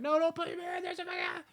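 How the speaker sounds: high-pitched mock pleading; mock panic. Blindfolded kid begging not to go in the pool, mock panic.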